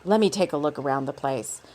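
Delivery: low, level character voice; low-voiced Dakar. A narrator's reading. Dakar tells Abigail he'll look around, low voice. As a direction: drop well down for his line.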